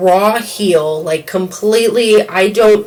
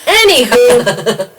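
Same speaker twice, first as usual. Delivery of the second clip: slow, high singsong anywho; drawn-out anywho; 4 semitones higher, 4 dB louder, much slower than usual. Pretend. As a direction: stretch it out playfully. Stretched transition word.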